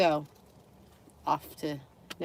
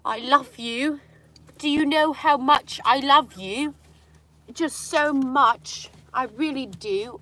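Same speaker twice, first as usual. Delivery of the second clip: higher, slow, doting coo; doting pet voice; 6 semitones higher, 3 dB louder, slower than usual. Retold or imitated. Owner cooing love to her dog, high and slow. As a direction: coo it sweetly to the dog, drawn out.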